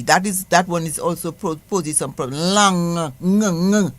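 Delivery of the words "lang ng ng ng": "Lang" ending in a long held "ng"; ng sound demo. Shown as a demonstration. Teacher drilling the Creole ng sound. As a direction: hum the "ng" at the back of the throat. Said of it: "combination sound is the N G".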